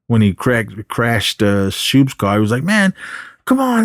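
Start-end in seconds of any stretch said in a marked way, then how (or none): 2.6-3.8 much higher-pitched protest; mock exasperation. Upset character protests after a crash, mock exasperated. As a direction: plead in a high, put-upon voice. Exasperated complaint in retold movie scene.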